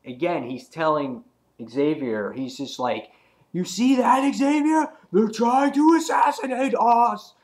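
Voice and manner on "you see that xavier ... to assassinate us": high, loud comic-book protest; hammed-up indignant hollering; imitated outraged shout. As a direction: yell the accusation as an embattled character. Voices Cyclops telling Xavier of attack.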